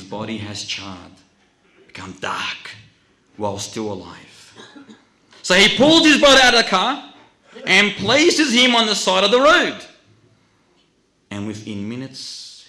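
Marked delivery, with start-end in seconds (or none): 5.4-9.8 loud, high-pitched dramatic narration; urgent preaching. Real, not acted. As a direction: hurl out the story at full force.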